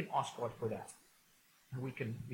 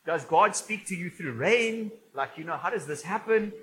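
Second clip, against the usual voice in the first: loud, higher, mock bewilderment; baffled outsider; 4 semitones higher, 10 dB louder than usual. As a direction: play a puzzled newcomer questioning it loudly. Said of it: "Guys are like".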